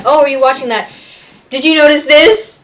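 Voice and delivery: eager question. Retold or imitated. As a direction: ask like you just spotted something. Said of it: "he's like".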